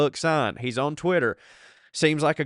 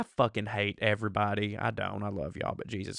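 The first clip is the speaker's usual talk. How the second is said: softer, lower muttering; quiet muttered aside; 4 semitones lower, 8 dB quieter than usual. Real, not acted. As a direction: mumble it under your breath.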